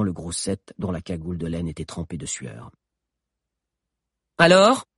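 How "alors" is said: high, loud challenge; loud demand. Acted. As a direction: demand an answer. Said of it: "interrogea Mordelia". («Alors?» lancé fort et aigu)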